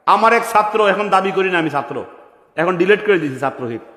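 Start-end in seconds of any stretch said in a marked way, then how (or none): none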